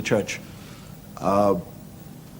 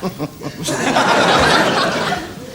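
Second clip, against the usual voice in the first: high, voiceless mock snigger; demonstrated laugh; 14 semitones higher, 4 dB louder than usual. Shown as a demonstration. Comic showing how Irish laugh at religion, mock sniggering. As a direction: snigger in short puffs. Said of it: "We laugh".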